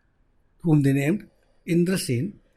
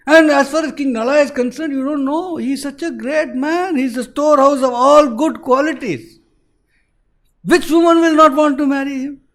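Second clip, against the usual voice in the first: higher, louder fervent praise; admiring Indra; 7 semitones higher, 4 dB louder than usual. Acted. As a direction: extol a great man.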